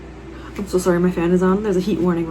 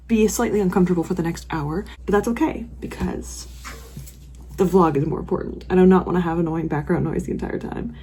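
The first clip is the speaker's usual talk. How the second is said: normal talking voice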